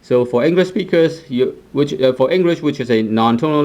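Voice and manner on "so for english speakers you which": normal talking voice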